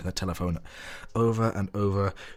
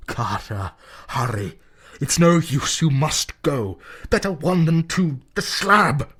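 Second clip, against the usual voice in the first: rising, louder desperate plea; pleading cry; 8 semitones higher, 6 dB louder, slower than usual. Acted. Warren begging Carter to leave, desperate cry. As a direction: plead harder with each line.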